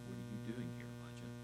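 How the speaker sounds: gentle voice. Acted. God gently asks hiding Elijah, very soft. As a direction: ask it like a gentle breeze. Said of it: "after this gentle breeze, a voice".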